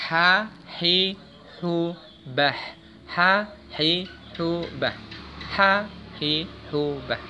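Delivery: "Ha, hi, hu, bah" with audible breath from a loosened mid throat; breathy ha drill. Shown as a demonstration. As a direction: let air out on each ha. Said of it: "agak dilonggarkan sedikit tenggorokan".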